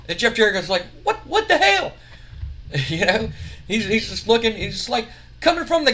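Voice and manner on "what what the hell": much higher, stunned outburst; shocked squeak. Retold or imitated. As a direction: yelp it in disbelief. Jeff shocked by insult.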